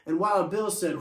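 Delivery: plain reading voice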